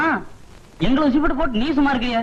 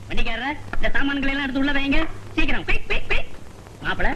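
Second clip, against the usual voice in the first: ordinary talk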